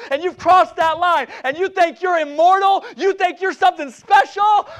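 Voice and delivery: loud preaching that climbs to a high shout; fired-up preaching. Acted. Preacher confronts fallen Samson, fired-up and rising. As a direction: build to a confronting pulpit shout.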